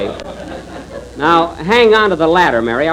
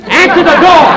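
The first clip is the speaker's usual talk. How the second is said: exasperated shout; 8 dB louder than usual. Acted. Jack on the ladder yelling at Rochester to answer, exasperated shout. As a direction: yell it much higher and louder.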